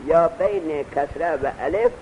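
ordinary talk

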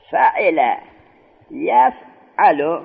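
یفعل stretched very slowly; teaching drawl on یفعل; slow drawn-out. Shown as a demonstration. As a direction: spell out یفعل slowly, syllable by syllable. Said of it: "teacher drawing out form".

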